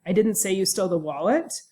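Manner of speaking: statement turned into a question, rising on "wallet"; question intonation. Shown as a demonstration. Lecturer questioning an accusation for students, question intonation rising on "wallet". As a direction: lift "wallet" at the end as if asking. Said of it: "to a question by using question intonation".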